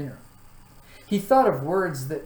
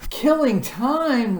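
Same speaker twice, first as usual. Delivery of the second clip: high, loud, slow outraged roar; furious roar; 9 semitones higher, 7 dB louder, much slower than usual. A narrator's reading. Watchdog is outraged at Milo, roaring slowly. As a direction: roar the words in disbelief. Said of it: "roared the dog".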